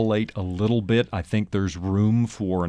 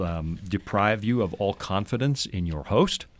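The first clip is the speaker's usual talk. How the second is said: usual speaking voice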